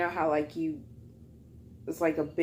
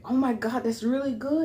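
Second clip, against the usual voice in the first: higher-pitched imitation of impressed customers; mock-impressed, pitched up; retold gushing praise; 7 semitones higher than usual. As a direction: lightly gush like an impressed customer.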